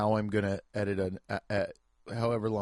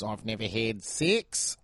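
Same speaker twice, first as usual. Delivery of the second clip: drawn-out mock Aussie accent; Australian accent; 2 semitones lower, 3 dB quieter, slower than usual. Comedian imitating ladies arriving on the show, in an Australian accent. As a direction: toss it in with a put-on Australian accent. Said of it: "the only thing I can say in an Australian accent".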